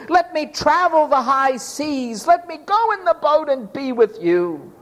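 high, earnest mock pleading; begging. Healed man begs Jesus to take him along, pleading.

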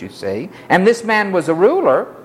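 normal talking voice

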